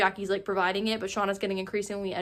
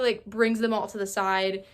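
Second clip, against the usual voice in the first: ordinary delivery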